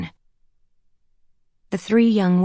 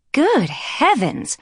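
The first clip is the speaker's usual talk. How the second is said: shocked; 9 semitones higher, much slower than usual. A narrator's reading. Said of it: "the redhead said".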